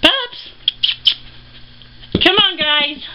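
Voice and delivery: very high bright call; calling the pets. Real, not acted. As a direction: squeal out to the rats. Calling the rats.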